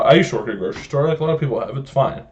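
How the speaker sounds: normal talking voice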